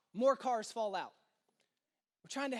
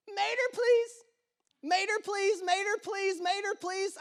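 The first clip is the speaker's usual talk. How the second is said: put-on pleading toddler; 7 semitones higher, 3 dB louder than usual. Child pleading for toy.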